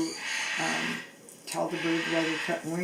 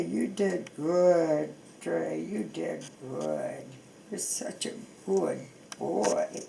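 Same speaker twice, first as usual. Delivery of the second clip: soothing the bird; slower than usual. Real, not acted. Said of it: "So now we just soothe him".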